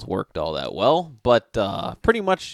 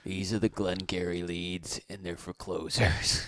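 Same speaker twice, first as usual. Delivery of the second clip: cold movie boss; 6 semitones lower, 8 dB quieter than usual. Pretend. Quoting Glengarry Glen Ross.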